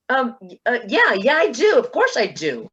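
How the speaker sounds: flustered bluffing. Retold or imitated.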